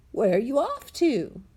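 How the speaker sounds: voiced as the father, lightly; a hint of a father asking; dad's voice. A narrator's reading. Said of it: "Dad asked".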